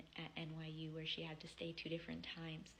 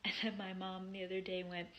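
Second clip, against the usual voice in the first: ordinary delivery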